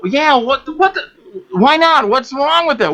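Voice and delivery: squeaky what-the outrage; mock indignation. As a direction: act baffled and offended. Retold indignant reply.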